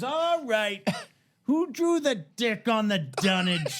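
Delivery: high, slow holler; retold yell. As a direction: bellow it out slowly for everyone to hear. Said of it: "he yelled it out".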